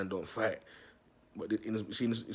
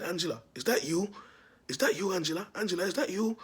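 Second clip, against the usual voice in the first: mock call; 9 semitones higher, 4 dB louder than usual. Mocking call to the stepdad.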